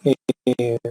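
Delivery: breaking up, bad connection audio; choppy audio. Real, not acted. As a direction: audio cutting in and out over bad internet. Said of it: "se les escucha entrecortado".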